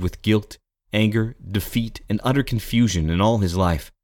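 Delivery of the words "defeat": usual speaking voice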